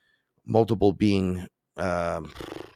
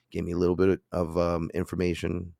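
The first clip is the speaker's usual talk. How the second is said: usual speaking voice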